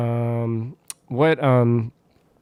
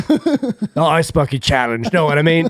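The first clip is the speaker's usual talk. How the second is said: Jason Statham; 3 semitones higher than usual. Acted. Star explaining a pee-holding challenge, mock Cockney. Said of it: "hit her with the Jason Statham".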